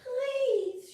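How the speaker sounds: high, pleading cry for help; begging. Acted. Blossom pleading with the nuts for help, high begging. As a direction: beg the nuts to save you. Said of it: "she begged".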